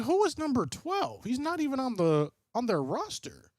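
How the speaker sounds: high, puzzled mock disbelief; retold baffled question. Streamer retelling his own confusion about number twelve, high and baffled. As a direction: ask it like you can't find him anywhere. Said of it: "I was like".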